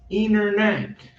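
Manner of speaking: Internet said as inernet with no T; silent T. Shown as a demonstration. Teacher shows how natives say Internet. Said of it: "como que ficaria?".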